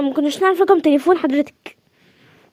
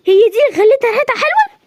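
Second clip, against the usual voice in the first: high, louder disbelieving question; shocked question; 7 semitones higher, 4 dB louder than usual. Acted. Shocked hostess learning the perfume hides fesikh. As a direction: gasp it out in disbelief.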